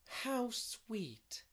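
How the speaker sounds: high, drawn-out delight; delighted exclamation. A narrator's reading. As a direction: exclaim it high, far from the narrating voice. Character exclaims in narrated fiction.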